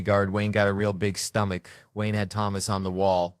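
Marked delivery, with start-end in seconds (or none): none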